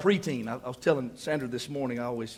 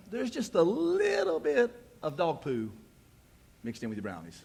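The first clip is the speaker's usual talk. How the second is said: sweet mocking mom; 3 semitones higher than usual. Mom revealing dog poo in the brownies, slow and sing-song. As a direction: drag it out with mock sweetness. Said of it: "she goes".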